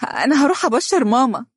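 overjoyed daughter; joyful. A narrator's reading. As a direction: burst out with happy news. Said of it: "تهتف بفرحة حقيقية".

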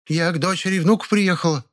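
high, offended protest; indignant old woman. A narrator's reading. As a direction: bristle at the charge. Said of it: "возмутилась та". (возмущённая старуха)

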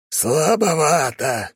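put-on high, slow, creaky old voice. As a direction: drag it out in a scratchy old voice. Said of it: "скрипучий голос Зорна".

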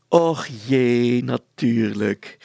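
slow, loud, drawn-out cooing; sugary sweet. A narrator's reading. Wife gets the crossword answer, sugary sweet. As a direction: stretch it out with a syrupy smile. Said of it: "glimlachte vrouw Brilstra zoetsappig".

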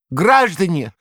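high, loud quavering call; rally the crowd in a thin trembling voice; thin vibrating cry. A narrator's reading. Korovyev calling to the crowd, thin quavering shout. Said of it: "вибрирующим тонким голосом прокричал".

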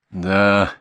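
long, loud, pondering "да"; lingering, drawled yes; drawn-out. A narrator's reading. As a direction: stretch the word out. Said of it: "протянул Матвей".

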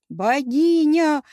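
high, loud drawn-out roar. A narrator's reading. Hero hailing both women at once, long loud roar. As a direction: bellow it long and adoringly. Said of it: "взревел тем временем Геракл".

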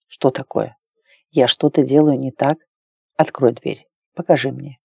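low, soft man's voice; coaxing male lover. A narrator's reading. Imagined lover asks her to open the door, low soft coaxing. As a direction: drop low and quiet to coax her.

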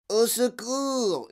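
high, drawn-out cry; howl for help. A narrator's reading. Monsieur Salt falls into the chute, howl for help. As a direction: stretch out a falling wail for help. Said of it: "hurla monsieur Salt".

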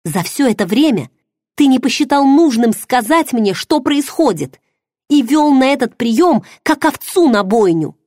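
high, loud, bitter accusing cry; furious. Acted. Heroine rages at her lover over his bride. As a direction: hurl the accusation at him.

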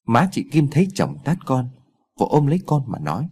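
plain reading voice

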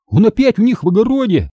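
high-pitched dismayed exclamation; startled cry. Acted. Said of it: "вскрикнул я". (встревоженный высокий возглас)

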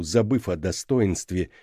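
usual speaking voice

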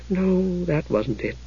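soft sigh of resignation. A narrator's reading. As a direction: let it out softly as a sigh. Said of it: "she said, sighing".

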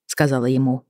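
plain reading voice

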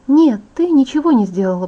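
ordinary delivery, nothing marked

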